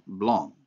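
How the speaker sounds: BLANC in French, ending on a nasal vowel, no N or C sounded; nasalized vowel. Shown as a demonstration. Lecturer correcting to the noun among nasal examples, nasalized vowel. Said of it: "a nasalized vowel".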